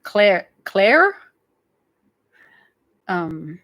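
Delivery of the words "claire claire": "Claire" tried with the final R, questioning; louder "Claire", testing the R at the end; sounded R. Shown as a demonstration. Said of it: "The outside of the R".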